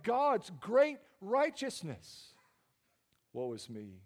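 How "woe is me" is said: soft, low woe is me; hushed broken admission; quiet confession. Retold or imitated. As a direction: confess it under your breath. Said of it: "He says".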